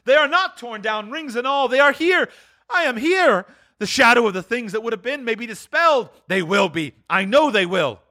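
high, elated exclaiming; overjoyed cry. Acted. Scrooge finding his things still here, elated high cry. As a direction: exclaim with giddy relief. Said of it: "cried Scrooge".

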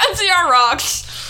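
high, loud, drawn-out cheer through laughter; mock fan cheer. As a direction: whoop it like a giddy superfan.

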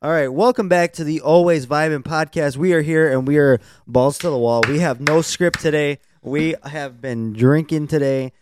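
upbeat hyped host intro; podcast host intro. Acted. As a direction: open the show like a host. Podcast intro, announcer style.

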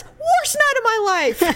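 high, exclaimed punchline; emphatic fan impression. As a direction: exclaim it like an exasperated sports fan. Fan's emphatic punchline, acted out.

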